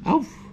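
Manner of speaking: a cute little yelp of oof; high-pitched playful oof. Real, not acted. Reacts to the puppies.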